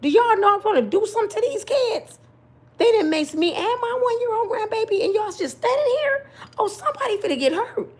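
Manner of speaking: high, frantic hollering; hollering at police. Retold or imitated. Grandma hollering at police about maced grandbaby. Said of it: "I said".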